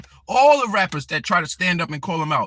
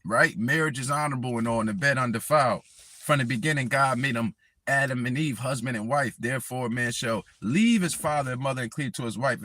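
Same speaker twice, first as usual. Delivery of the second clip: low, measured sermon voice; preaching scripture; 7 semitones lower, 4 dB quieter than usual. Acted. Vlogger quoting the Bible at Don Lemon, preaching cadence. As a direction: recite it like a preacher.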